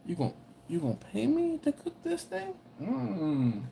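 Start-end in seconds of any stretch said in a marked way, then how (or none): 0.1-2.5 high, incredulous you gonna pay me; hammed-up outrage at the request; mock disbelief. Cook answering a customer in mock disbelief. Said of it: "And you're like".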